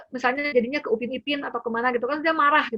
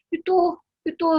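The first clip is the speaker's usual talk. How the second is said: put-on high, pushy child pointing; demanding child; 8 semitones higher, 4 dB louder than usual. Child pointing at what he wants, pushy and high. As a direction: whine it like a toddler pointing. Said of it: "kadang-kadang cuma nunjuk-nunjuk aja".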